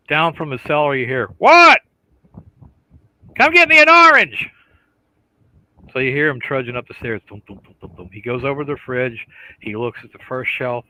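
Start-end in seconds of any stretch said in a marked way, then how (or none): 1.4-1.8 high, loud yell from the cellar; shouting back. Acted. Husband answers from the cellar. 3.4-4.5 loud, high holler down the stairs; yelled demand. Acted. Wife yells order down to cellar. 7.3-8.1 very soft, muttered thumps; imitated trudging footsteps. Imitates feet trudging upstairs.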